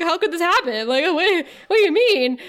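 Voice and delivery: imitating a wooden actor trying to sound upset; getting upset. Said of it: "[name]'s starting to get upset".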